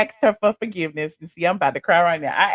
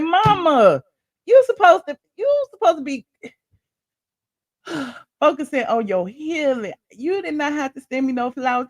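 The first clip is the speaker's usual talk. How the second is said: higher-pitched playful scolding of mama; retold loving protest; 6 semitones higher than usual. Daughter chiding her mother for sending flowers, fond protest. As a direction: plead fondly, high then easing down.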